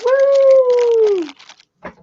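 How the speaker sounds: long, very high, loud woo; excited whoop. Real, not acted. Streamer shows off gifted pens, long excited whoop. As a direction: hold a high celebratory whoop.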